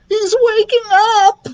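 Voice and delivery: very high, drawn-out squeaky sob; hammed-up crying voice; sobbing. A narrator's reading. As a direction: wail it in panic. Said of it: "Lucas sobbed".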